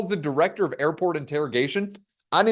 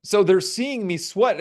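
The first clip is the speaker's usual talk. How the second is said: ordinary talk, nothing marked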